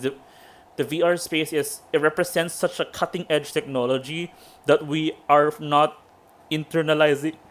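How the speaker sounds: ordinary talk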